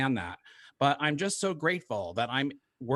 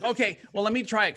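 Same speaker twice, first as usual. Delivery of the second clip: ordinary talk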